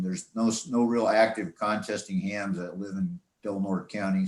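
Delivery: ordinary delivery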